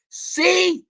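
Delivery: husband yelling at his wife with the gold in hand, angry; triumphant angry husband. Retold or imitated. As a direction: throw it in her face, yelling. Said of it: "you tell your wife".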